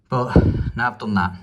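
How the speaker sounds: panting after the effort; breathless from the work; out of breath. Real, not acted. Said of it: "I'm out of breath".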